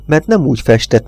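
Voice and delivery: ordinary delivery, nothing marked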